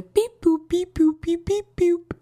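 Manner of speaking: high playful beep boop noises; phone dial beeps. Pretend. Viewer dialing the crush's number, playful high beeps. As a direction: chirp the keypad tones up high.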